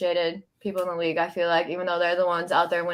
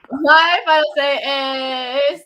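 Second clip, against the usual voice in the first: dragged out; 4 semitones higher, 6 dB louder than usual. Shown as a demonstration. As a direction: draw out each word for suspense. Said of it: "Dragging it out".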